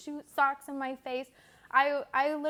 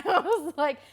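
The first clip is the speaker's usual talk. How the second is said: usual speaking voice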